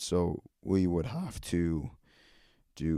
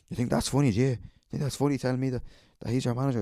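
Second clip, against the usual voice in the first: mock angry customer; 6 semitones higher, much faster than usual. Angry customer comes back.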